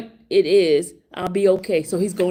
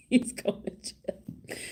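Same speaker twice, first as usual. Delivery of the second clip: laughing; 11 dB quieter, slower than usual. Real, not acted. Laughing aside.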